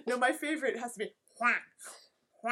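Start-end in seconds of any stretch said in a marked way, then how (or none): 1.4-2.5 quick playful quack; mock duck quack. Favorite animal noise, a duck in French, mock quack. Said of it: "is that the duck makes".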